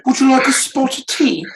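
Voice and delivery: very high, prim mock British voice; posh English lady. Mocking English followers in a prim high British voice. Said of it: "mocking English followers".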